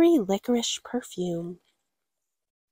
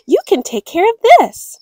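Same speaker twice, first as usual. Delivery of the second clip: higher, louder cheerful offer; parents' voice; 8 semitones higher, 9 dB louder than usual. Acted. Parents handing over a box, bright loud offer. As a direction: present it brightly. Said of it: "said her parents".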